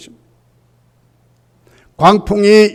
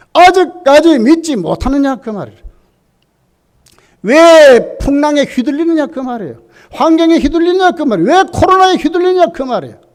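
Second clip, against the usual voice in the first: rising rebuke; 7 semitones higher than usual. Real, not acted. Preacher rebuking congregation.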